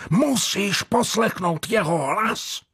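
high-pitched fanatical urging; urgent. A narrator's reading. Jordan demands Jean obey the voice, urgently. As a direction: demand obedience, slowing and strained high on the last words. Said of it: "pokračoval naléhavě Jordan".

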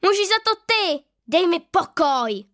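loud, high blaming shout, slowing at the end; a girl yelling blame at her friend; angry outburst. A narrator's reading. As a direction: push her friend away with anger. Tereska blames Linda angrily.

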